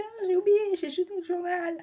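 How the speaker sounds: high, loud imitation of mom; mother's voice. Vlogger imitating her mom over the thrown-out newspaper. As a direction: mimic mom's careless apology. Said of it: "Elle a dit".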